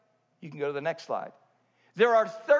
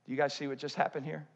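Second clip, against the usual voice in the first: quiet urgent question to the crowd; hushed question; 7 semitones lower, 7 dB quieter, faster than usual. Real, not acted. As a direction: drop to a hushed, quick ask. Quiet after apologising for yelling.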